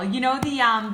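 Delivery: usual speaking voice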